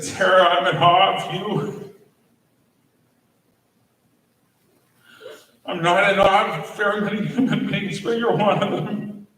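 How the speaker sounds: fighting back tears as he remembers; voice breaking with emotion. Real, not acted. Eulogy speaker recalls telling dying colleague he's in awe, voice breaking.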